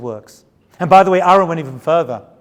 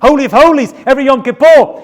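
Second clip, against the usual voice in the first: normal talking voice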